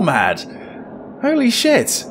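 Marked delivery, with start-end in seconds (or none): 1.2-2.0 shocked. Real, not acted. Shocked by game.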